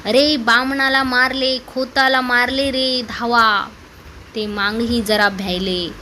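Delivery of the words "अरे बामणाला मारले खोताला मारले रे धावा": usual speaking voice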